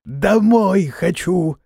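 high, drawn-out creature howl across the swamp; slow, high wail of a homesick hag; yelling. A narrator's reading. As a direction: wail it out slowly to the whole bog. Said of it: "вдруг заорала на всё болото".

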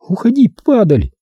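high, choked woman's voice; strained voice. Acted. Corola chokes out words at the narrator. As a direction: force it out through a tight throat. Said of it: "сказала она сдавленным голосом".

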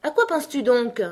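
severe, pointed questioning; stern reproach. A narrator's reading. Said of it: "d'un ton sévère".

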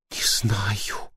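faint whisper; very old faint voice. Acted. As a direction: barely breathe the words. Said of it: "слабым голосом ответила Сандра".

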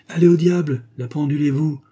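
s'écrier plus haut et plus fort, furieux; lancez-le comme un maître à bout de patience; hors de lui. A narrator's reading. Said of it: "s'écria Maxou hors de lui". (cri furieux de l'avocat contre sa servante)

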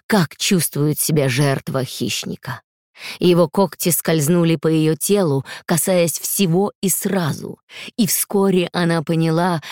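ordinary delivery, nothing marked

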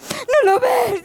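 high, crying voice; tearful plea. Acted. Truth answering the Lie, high tearful plea. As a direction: plead through tears. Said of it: "dijo la verdad".

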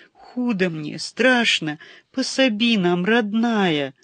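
high, loud tearful complaint; sobbing. Acted. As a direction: cry out like a frightened child. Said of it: "Сколько он ещё так рыдал".

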